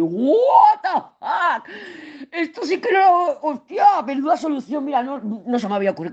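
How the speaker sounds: hammed-up shock at an idea out of nowhere; astonished discovery. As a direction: squeal as the answer suddenly clicks. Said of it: "soluciones de decir".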